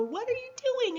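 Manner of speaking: high, loud laughing question; laughing disbelief. Retold or imitated. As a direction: burst out laughing as you ask. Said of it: "I bust out laughing and go".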